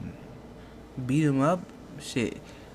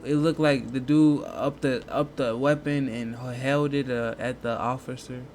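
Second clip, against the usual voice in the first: normal talking voice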